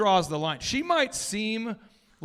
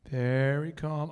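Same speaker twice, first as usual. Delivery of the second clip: slow, lower, deliberately calm imitation of a wife; 4 semitones lower, 3 dB quieter, slower than usual. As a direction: stretch it out with exaggerated calm. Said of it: "how my wife communicates is like this, very calm".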